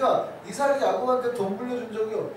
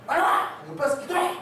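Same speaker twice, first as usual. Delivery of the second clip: high, loud mock yell of a pastor; shouting pastor; 6 semitones higher, 3 dB louder than usual. Pastor ordering the group over to pray, shouting. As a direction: bark orders as an imitated pastor. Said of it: "소리 쳐버린단 말이에요".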